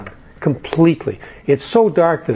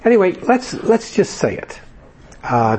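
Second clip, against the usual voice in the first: usual speaking voice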